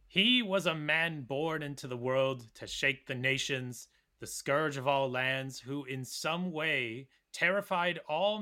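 slow, measured dramatic narration; dungeon master voice. Acted. Co-host reads Jordanes's quote on Attila, dungeon master voice. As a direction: read it like a game master unveiling a legend, slowly. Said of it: "use his wonderful D M voice".

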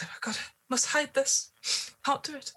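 high, panicked whisper; terrified hushed whisper; frantic whisper. Acted. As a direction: whisper it in a frantic rush to hide. Panicked NPC hiding the body.

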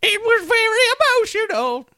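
put-on very high, old-lady London accent; badly faked London old lady; terrible London accent. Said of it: "London accent".

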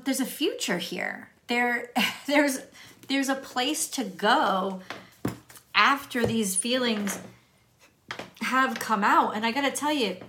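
ordinary talk

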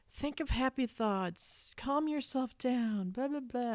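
slow, soft, sing-song calming tone; slow hushed mock-zen advice; mock soothing husband. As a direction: imitate a husband telling her to calm down. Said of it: "he's always like".

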